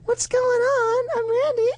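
put-on very high falsetto girl voice; squeaky high put-on woman; female voice.

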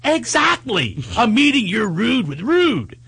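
slow, high and deliberate, leaning on rude; relishing her retort; stress on rude. Real, not acted. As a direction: spell out rude with rude like a motto. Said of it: "pleased with her own rule".

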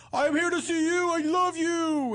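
high, drawn-out heckler impression. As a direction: holler devotion like a rowdy fan. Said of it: "he was heckling me like this".

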